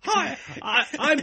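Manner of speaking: high-pitched mock American-English accent; laughing through a hammed-up American-English accent. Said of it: "she's got a weird American English accent".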